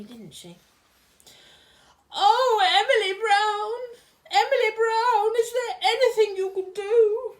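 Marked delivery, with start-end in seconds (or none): 2.1-7.3 high, loud, wailing posh queen; posh crying Queen voice. Acted. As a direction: sob it out like a spoilt queen. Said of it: "Doing that funny voice again".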